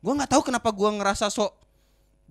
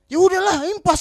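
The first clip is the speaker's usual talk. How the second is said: loud, much higher mock outburst; angry mother; 11 semitones higher, 9 dB louder than usual. Mother walking away from the crash, retold angry dismissal. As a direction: imitate a mother angrily ending the argument. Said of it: "orang tuanya datang marah-marah".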